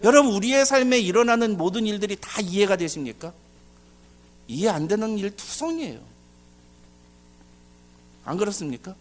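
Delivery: usual speaking voice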